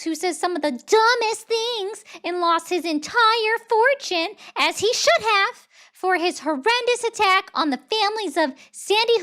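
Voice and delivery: loud, high-pitched ranting impression; high, forceful mock-presidential rant; Donald Trump. Streamer reading a rambling Trump post, high-pitched impression. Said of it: "I cannot do a Trump voice ... which is a high-pitched voice".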